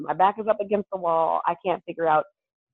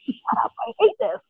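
mock annoyed; 13 semitones higher than usual. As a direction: snap back in fake annoyance, pitched up. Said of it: "I'm like".

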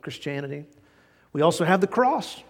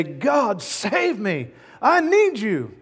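pleading sinner; 6 semitones higher, 4 dB louder, much slower than usual. Acted. Sheep's prayer voiced in sermon.

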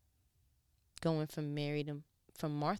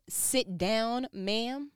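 higher, louder mock rebuke; mock stern rebuke; 6 semitones higher, 6 dB louder, much slower than usual. Preacher quotes being told to sit down, mock stern. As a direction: snap it like a firm correction.

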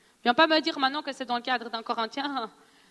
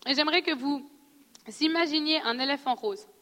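guided visualization; 4 semitones lower than usual. Acted. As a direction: lead the room gently into picturing it. Leads a visualization exercise.

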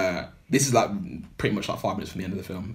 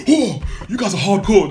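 much higher, louder imitation; mock amazed exclamation; 12 semitones higher, 10 dB louder than usual. Retelling the drowned lad's reaction, mock amazed. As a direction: exclaim it like someone impressed, hammed-up. Acts out drenched character's reaction.

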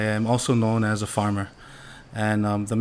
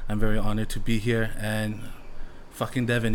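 ordinary talk, nothing marked

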